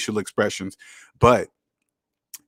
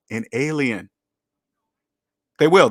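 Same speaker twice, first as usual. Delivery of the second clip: ordinary delivery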